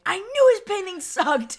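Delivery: high, loud mocking outburst; mock crowd outrage. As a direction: shriek it like the smug crowd caught out.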